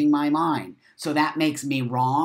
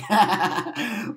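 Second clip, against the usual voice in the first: laughing; 8 semitones higher, 5 dB louder than usual. Real, not acted. Laughs at own joke.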